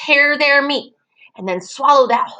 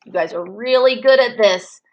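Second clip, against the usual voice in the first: ordinary talk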